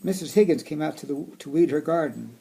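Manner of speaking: storybook reading voice; reading to children. Acted. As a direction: read it aloud to kids. Reading picture book aloud.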